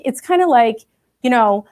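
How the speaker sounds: ordinary talk, nothing marked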